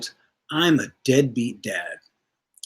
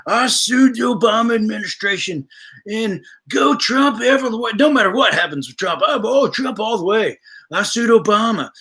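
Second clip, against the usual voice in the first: high, punchy mock boast; mocking Ken Paxton; 10 semitones higher than usual. Streamer mimics the attorney general bragging about who he's suing, high mocking boast. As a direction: crow it in an exaggerated high-pitched voice. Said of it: "talks about who all he's suing".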